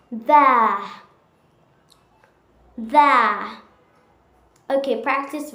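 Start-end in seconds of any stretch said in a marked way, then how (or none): 0.1-3.5 there said thair, no r; British accent. Shown as a demonstration. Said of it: "Fifth thing is".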